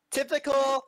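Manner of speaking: high, loud put-on voice; hammed-up cover story. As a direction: squeal it out loud, drawing out the last words. Player jokingly voices character's cover story.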